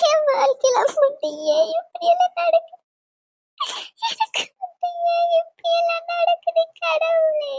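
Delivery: high, drawn-out wail; crying. Acted. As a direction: sob out a despairing lament to God. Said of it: "அழுகாத Jennifer".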